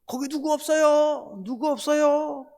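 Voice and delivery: imitating a man hanging from a cliff calling out; calling for help. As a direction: call out into the void, stretched. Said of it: "막 소리 질렀대잖아요".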